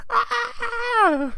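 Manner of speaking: loud, very high shriek; mock horror scream. Mock horror at the freezer detail.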